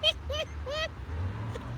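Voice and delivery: high, soft wheezing laugh; helpless laugh. Real, not acted. Host reading Anthony's comment, high helpless laughter.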